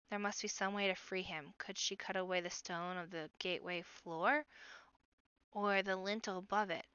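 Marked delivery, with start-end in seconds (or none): none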